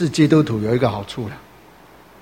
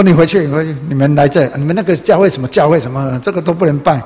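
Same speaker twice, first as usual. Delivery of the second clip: angry yelling; 3 semitones higher, 6 dB louder than usual. Retold or imitated. Family yelling at church visitors to leave, loud angry shouting. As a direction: shout them out the door. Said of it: "一直骂了".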